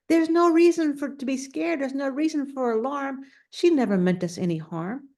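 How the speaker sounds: high, eager telling; excited news. A narrator's reading. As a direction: rush the good news to every bug. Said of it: "went from bug to bug to say".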